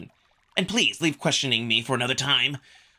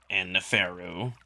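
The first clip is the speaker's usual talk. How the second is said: dismissive; 6 semitones lower, 4 dB quieter than usual. A narrator's reading. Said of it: "takes on a dismissive tone".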